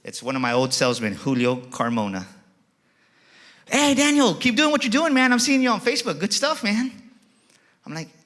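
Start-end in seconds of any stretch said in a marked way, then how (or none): 3.6-6.9 loud, high-pitched cheering on; shouted encouragement. Retold or imitated. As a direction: holler praise across a car lot. Salesman shouts encouragement across the lot.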